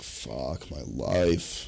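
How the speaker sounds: low, slow groan of regret; comic self-pity; mock despair. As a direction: drag it out, low and defeated. Acts regret over bar bill.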